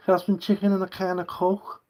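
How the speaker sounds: high-pitched mock Scouse accent; Scouse impression. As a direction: put on a comically high Liverpool accent. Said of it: "Scousers, you can't understand a word they say".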